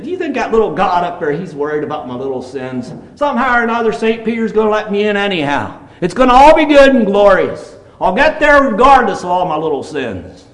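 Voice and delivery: high, increasingly loud mock confidence; hammed-up carefree assurance, pitched well up; imitating breezy neighbour. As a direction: play someone blithely sure of getting away with it. Acts out the neighbour's careless confidence.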